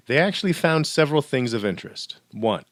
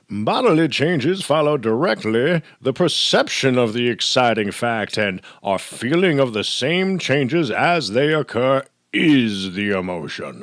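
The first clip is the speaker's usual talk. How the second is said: put-on old-timey voice; 4 dB louder than usual. Lecturer grandly quoting William James's article, old-timey voice. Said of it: "in my old-timey voice".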